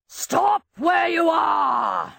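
a slow, drawn-out roar from afar; the Baron shouting at trespassers, roaring. A narrator's reading. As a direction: bellow it like an angry baron. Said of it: "roared the Baron's voice".

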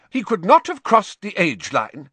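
sharp, high, loud retort. A narrator's reading. McGonagall defending Harry, sharp. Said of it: "said Professor McGonagall sharply".

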